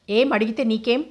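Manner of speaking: usual speaking voice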